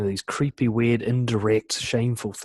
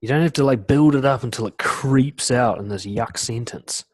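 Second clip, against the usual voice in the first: normal talking voice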